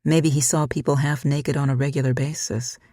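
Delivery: usual speaking voice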